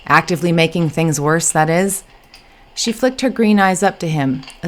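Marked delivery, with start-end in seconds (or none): none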